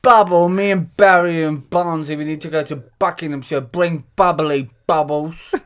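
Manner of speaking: hammed-up drunk, dragging out the words; drunk caller. Drunk woman ringing Bubble for a lift home, hammed-up slurring. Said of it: "She's drunk, Bubble".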